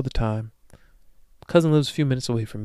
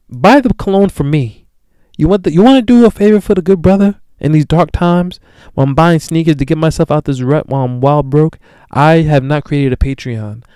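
louder, higher pleading rant; 3 semitones higher, 8 dB louder than usual. Real, not acted. Begging listeners to buy him cologne, loud plea. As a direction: plead loudly for the favor.

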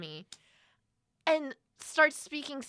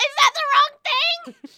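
high, loud mock panic; panicked question; 14 dB louder than usual. Nervous student asking a classmate, loud mock panic. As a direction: squeal it in alarm.

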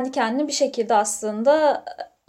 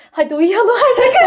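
laughing; 13 semitones higher, 9 dB louder than usual. Real, not acted. Sleepy joke, laughing.

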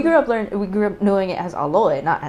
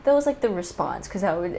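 ordinary delivery

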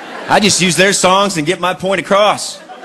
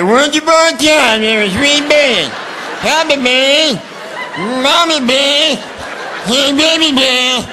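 high, very slow, garbled warble; muffled through fluid; 9 semitones higher, much slower than usual. Retold or imitated. The story as the baby hears it in the womb, high and slow. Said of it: "To him, it sounds like".

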